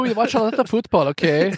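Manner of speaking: put-on German accent. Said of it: "I can't do a German accent".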